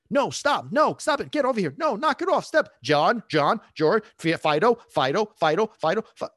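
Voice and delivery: rapid-fire loud, high scolding; frustrated dog owner. Acted. Owner shouting at a misbehaving dog, frustrated. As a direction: scold the dog in escalating, repeated commands.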